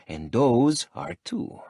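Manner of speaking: slow, dry sarcasm; drawn-out sardonic reply. Acted. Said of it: "my sardonic tone".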